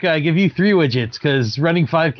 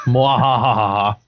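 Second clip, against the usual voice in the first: mad scientist laugh; 5 semitones lower, much slower than usual. Acted. Gloating after being called athlete.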